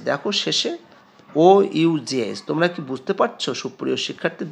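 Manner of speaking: ordinary talk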